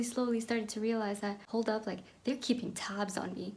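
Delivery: usual speaking voice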